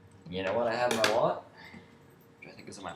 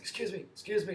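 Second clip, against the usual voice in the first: higher mock smoker rasp, repeated call; old smoker voice; 11 semitones higher, 3 dB quieter than usual. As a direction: imitate the guard's smoky voice calling after someone. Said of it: "forty-year smoker kind of voice".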